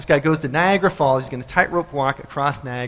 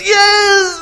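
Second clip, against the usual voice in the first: high, loud drawn-out cheer; screaming crowd; 9 dB louder than usual. Acted. Crowd as the blindfold comes off, screamed cheer. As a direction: scream with wild excitement. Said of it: "they scream and shout".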